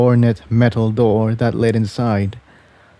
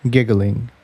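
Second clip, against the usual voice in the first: ordinary delivery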